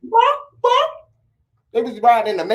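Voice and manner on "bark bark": squeaky high barking; high mock bark. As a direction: bark like a tiny chicken. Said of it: "They say".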